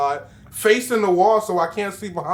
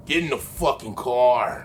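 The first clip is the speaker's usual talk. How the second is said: creepy low voice; 9 semitones lower, 3 dB quieter than usual. Retold or imitated. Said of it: "That's how he says".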